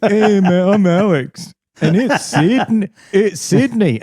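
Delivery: high, loud, cocky know-it-all; hammed-up, pleased-with-himself voice; smug party bore. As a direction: lean in and boast like a pub bore. Retold own smug boast.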